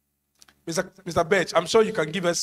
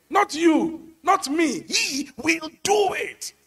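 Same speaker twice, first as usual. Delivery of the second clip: ringing climax; 9 semitones higher, slower than usual. Acted. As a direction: shout the truth to the church. Preacher's climax declaration.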